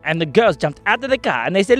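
ordinary delivery, nothing marked

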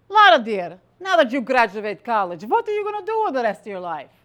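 put-on loud mother with a Ukrainian accent. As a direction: question your daughter in a thick Ukrainian accent. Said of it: "said in her beautiful Ukrainian accent".

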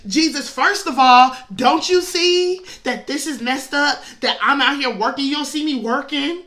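loud exasperated scolding; a fed-up sister griping at Jesus; fed-up complaint. Retold or imitated. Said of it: "she gets so fed up".

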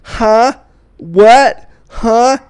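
mock a rude confused American; mocking rude American what; rude American huh. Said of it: "It's when you say".

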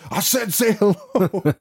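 mock-stern demand, slowed down; mock stern insistence. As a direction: repeat the order with exasperation. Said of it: "They're like".

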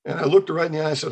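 normal talking voice

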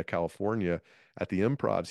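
ordinary talk

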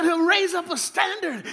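high, slow, preached cry; stirred-up preacher. Retold or imitated. Retelling an Alabama offering preacher, high, slow and preached. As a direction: draw out the verse like a preacher working up a frenzy. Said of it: "I said".

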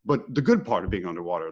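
ordinary delivery

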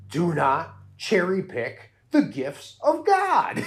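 slow, high zealot swinging up and down; Bev's crazy ebb and flow. Retold or imitated. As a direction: punch every other word like a crazed church lady. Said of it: "ebb and flow of crazy in her voice".

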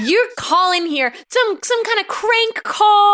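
high, slow outraged father; angry disbelief. Acted. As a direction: drag out the accusation in outrage. Parents accuse a crank caller.

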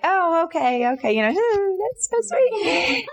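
high, slow, laughing polite reply; mock sweet. To the shop owner filling in, high sweet politeness. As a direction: humour him, sweetly and slowly. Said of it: "And I was like".

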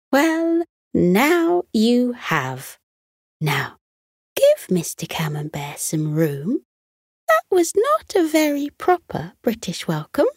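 motherly voice. Acted. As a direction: gently tell the children off. Mother stoat speaks to children.

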